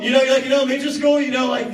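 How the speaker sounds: ordinary talk, nothing marked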